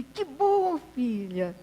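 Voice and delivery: overjoyed grandpa welcoming a daughter; delighted old man. Acted. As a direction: exclaim it joyfully, then drop down.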